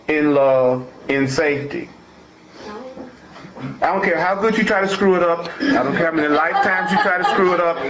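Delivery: fired-up preaching. Acted. Preacher driving the point home to the congregation, fervent. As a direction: preach it with rising fire. Preaches that all end in love.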